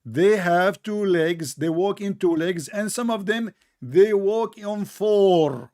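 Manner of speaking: drawn-out, emphatic phrases with pauses; slow, careful explaining. Shown as a demonstration. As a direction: make them listen to every word. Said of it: "Listen carefully".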